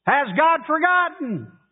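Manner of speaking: high, anguished plea on God; lifting the question high and aching; pleading question. Acted. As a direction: cry it up to heaven. Impassioned reading of the psalm.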